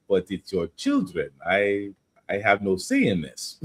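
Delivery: low, mock Russian accent. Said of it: "I read it in a Russian accent".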